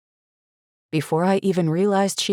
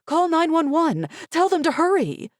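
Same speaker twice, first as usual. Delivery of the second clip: frantic yell; 12 semitones higher than usual. A narrator's reading. Mother yelling at waitress.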